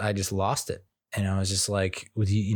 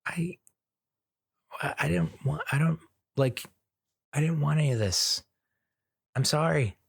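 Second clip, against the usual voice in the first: higher, halting apology; choked-up apology; 6 semitones higher than usual. Retold or imitated. Dad apologizing to his crying daughter, choked-up. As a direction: push the words out close to tears. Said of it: "And I was just like".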